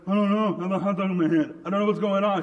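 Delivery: high, loud, hurried protest; flustered little sister. Retold or imitated. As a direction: play a small child frantically pleading ignorance. Flustered little sister answers panicking dad.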